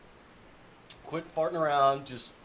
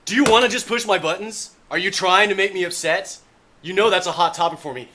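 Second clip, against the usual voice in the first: angry; 7 semitones higher, 13 dB louder than usual. Acted. Ranting about a sore topic.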